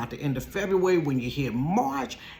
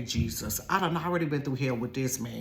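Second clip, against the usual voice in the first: normal talking voice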